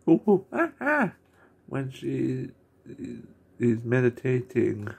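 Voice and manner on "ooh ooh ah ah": ooh-ah noises. Retold or imitated. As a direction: coo it like a cartoon character. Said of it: "Emmy going".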